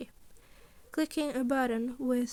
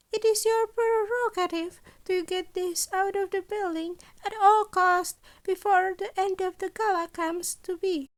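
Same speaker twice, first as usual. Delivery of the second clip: higher, brighter little principal voice; a scheming principal, a touch higher and louder; Nezu's voice; 7 semitones higher, 5 dB louder than usual. A narrator's reading. Principal Nezu explaining his deal, higher and louder.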